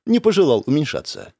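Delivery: usual speaking voice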